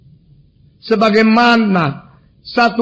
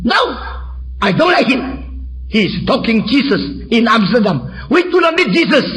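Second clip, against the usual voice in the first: raised pitch, indignant protest; imitating angry heckler; 4 semitones higher than usual. Dutch heckler answering the preacher's question, angrily. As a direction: act out an irate objector. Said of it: "Wah, dia marah sekali".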